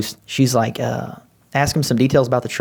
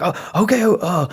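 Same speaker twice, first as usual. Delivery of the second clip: nervous stammer; 9 semitones higher than usual. Retold or imitated. Dude froze, nervous.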